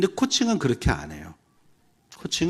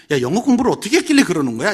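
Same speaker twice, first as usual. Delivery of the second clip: quick, louder retold rebuke; mock scolding; 5 semitones higher, 7 dB louder, faster than usual. Mentor scolds someone struggling with English, mock reproach. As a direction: fire off a reproachful question.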